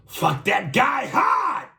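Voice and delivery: loud, drawn-out high shout; hammed-up boxing trainer holler; Rocky's trainer Mickey. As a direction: yell slow, high coaching orders at your fighter. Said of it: "The way you impersonated Rocky".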